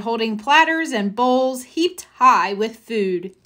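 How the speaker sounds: ordinary delivery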